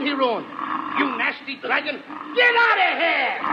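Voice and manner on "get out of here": yell. Acted. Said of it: "Don't yell at me".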